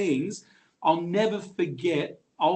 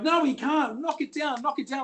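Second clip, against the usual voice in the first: protesting woman; 10 semitones higher than usual. Retold or imitated. Leading bidder protesting.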